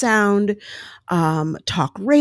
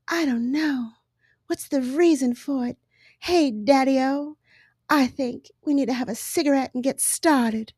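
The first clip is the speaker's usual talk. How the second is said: higher and softer 1940s radio-play dame; old-timey radio dame; 6 semitones higher, 4 dB quieter than usual. Retold or imitated. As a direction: purr it like a radio-show femme fatale. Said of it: "there'd be this woman who'd be like".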